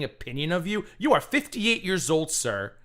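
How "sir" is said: normal talking voice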